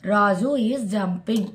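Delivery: very slow, word by word; slow model sentence. Shown as a demonstration. Teacher reading an example slowly to students. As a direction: lay each word down for the class.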